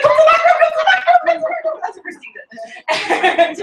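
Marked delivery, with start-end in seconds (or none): 0.0-1.8 high, urgent repeated call; urgent director. Retold or imitated. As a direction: beckon her quickly to the back room. Mimics sales director calling her.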